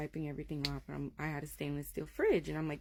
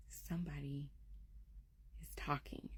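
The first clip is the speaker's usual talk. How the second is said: soft, halting near-whisper; hushed child; 11 dB quieter than usual. Retold or imitated. Daughter tells mother about a voice, hushed. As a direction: report it quietly, with a pause midway. Child reports hearing a mysterious voice.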